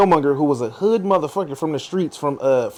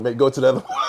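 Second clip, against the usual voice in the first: bursting into laughter; can't stop laughing at the picture; 13 semitones higher, 6 dB quieter, faster than usual. Real, not acted. Group cracking up at picture.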